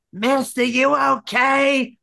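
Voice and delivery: high, drawn-out caricature of a busybody; nosy lady. Retold or imitated. Lady at the next table checking on him, hammed-up nosy concern. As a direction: put on a meddling woman's voice, stretching the words. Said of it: "the lady next to us decides to get involved".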